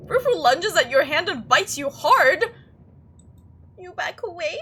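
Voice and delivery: very loud, high-pitched story reading; alarmed narration. A narrator's reading. Player reading out Frou Frou biting their hand, alarmed shout. Narrating the sudden attack excitedly.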